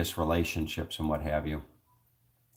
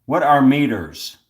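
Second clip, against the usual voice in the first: loud, higher quiz answer; raised contestant's reply to a device; calling out answer; 6 semitones higher, 10 dB louder than usual. Real, not acted. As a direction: project it for the machine to catch.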